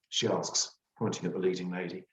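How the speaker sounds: plain reading voice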